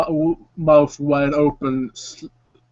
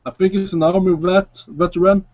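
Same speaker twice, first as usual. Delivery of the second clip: Javier's voice; 4 semitones higher than usual. Acted. Javier explains his friend to the stranger, easygoing and higher. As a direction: talk your jumpy friend down, casual. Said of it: "taps his friend and says".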